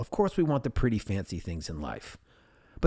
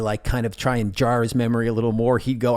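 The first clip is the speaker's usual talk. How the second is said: ordinary talk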